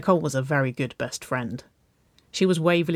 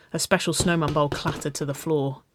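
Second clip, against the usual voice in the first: usual speaking voice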